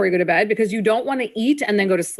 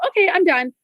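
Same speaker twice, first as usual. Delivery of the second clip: breezy; 8 semitones higher than usual. Retold or imitated. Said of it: "and then you go".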